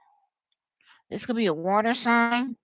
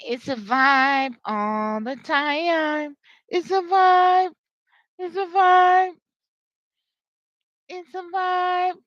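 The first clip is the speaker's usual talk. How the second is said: drawn-out catchphrase; 7 semitones higher, 3 dB louder, much slower than usual. Acted. Acted catchphrase, repeated and stretched.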